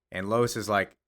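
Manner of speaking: ordinary talk, nothing marked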